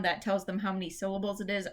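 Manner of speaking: ordinary talk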